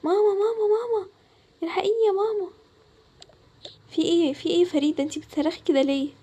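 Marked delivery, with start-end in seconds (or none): 0.0-2.5 high, panicked calls for mama; frightened cry for mom. Acted. Scared girl calls her mother for help, high and panicked. Farida wakes terrified, calling mother.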